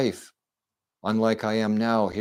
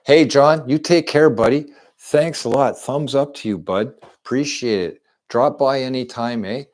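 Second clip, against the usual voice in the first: loud, higher-pitched friendly send-off; raised voice, casual and friendly; calling out goodbye; 4 semitones higher, 5 dB louder than usual. Real, not acted. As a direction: call a cheery goodbye to a buddy.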